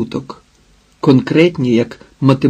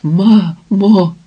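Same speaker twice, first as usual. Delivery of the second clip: higher-pitched, anguished call with a falling end; bitter choked cry; 6 semitones higher, 3 dB louder than usual.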